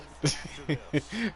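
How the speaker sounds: high laughter that hurts; laughing. Real, not acted. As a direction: laugh though it stings. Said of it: "Ow, it hurts to laugh".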